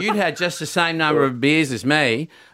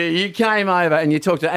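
ordinary talk, nothing marked

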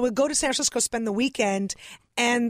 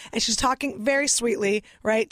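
ordinary delivery

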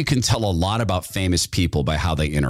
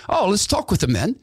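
cheerful quote; 10 semitones higher than usual. Retold or imitated.

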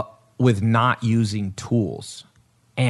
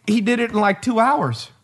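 amazed; 11 semitones higher than usual. Real, not acted. Amazed retelling.

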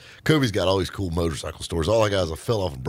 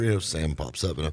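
usual speaking voice

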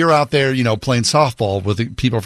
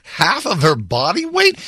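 incredulous; 7 semitones higher, slower than usual. Real, not acted. Incredulous echo of doctor's advice.

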